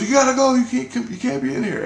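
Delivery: high, loud commanding warning; Jesus alarmed. Retold or imitated. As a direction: order the intruder out urgently. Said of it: "He goes".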